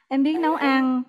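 ordinary delivery

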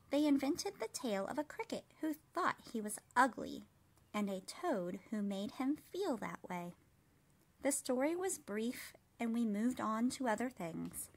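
put-on gentle, even read-aloud voice for children; storybook reading. A narrator's reading. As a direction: read it like bedtime story time. Reads picture book aloud.

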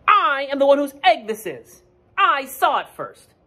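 high, loud and boastful, slowing at the end; proud Duck. Acted. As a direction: puff up your chest and brag. Duck claims the egg.

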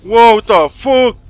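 slow, loud disbelief; stunned. Real, not acted. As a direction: drag it out in shock. Incrédulo tras el ataque. (incrédulo, lento y fuerte)

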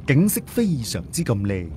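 ordinary delivery, nothing marked

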